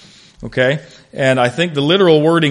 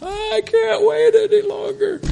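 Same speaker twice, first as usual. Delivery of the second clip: high, slow, put-on whine; whiny impatient plea; slower than usual. A high whining voice telling God he can't wait. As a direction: play the impatient child begging God. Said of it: "I'm like".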